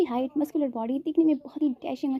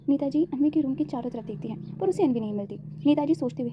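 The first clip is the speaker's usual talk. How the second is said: ordinary delivery, nothing marked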